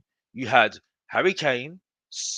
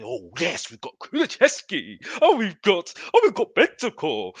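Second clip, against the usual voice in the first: high, giddy mock fan gushing; 6 semitones higher than usual. Fan mocking fellow fans, high gushing fan voice. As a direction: gush over every player like a besotted fan. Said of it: "the same players that we are looking at and saying".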